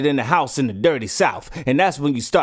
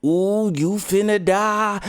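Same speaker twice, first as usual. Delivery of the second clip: drawn-out, high taunt; teasing doom warning; 5 semitones higher, slower than usual. Acted. Narrator tells the character he's doomed, drawn-out taunt. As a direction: stretch it out slowly, high and teasing.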